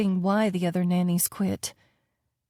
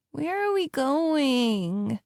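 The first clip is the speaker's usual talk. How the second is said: put-on higher, slow whiny child; whining child; 6 semitones higher, much slower than usual. A narrator's reading. Girl whines from the back seat, bored and whiny. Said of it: "Maya whined".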